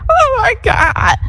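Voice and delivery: very high, stretched-out imitated cry; a slow mock wail at the top of the voice. Retelling how she cried at the opened door, mock crying. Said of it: "Now I'm just buku cry".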